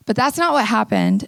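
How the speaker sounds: ordinary talk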